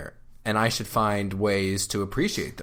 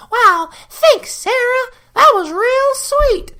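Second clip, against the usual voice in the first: high, slow sugary falsetto of fake thanks; mock gratitude; 9 dB louder, slower than usual. Husband mocking the reply his wife wants, high mock falsetto. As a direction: squeal it like a cartoon thank-you.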